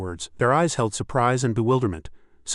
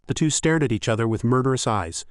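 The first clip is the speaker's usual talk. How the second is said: plain reading voice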